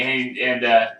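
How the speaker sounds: normal talking voice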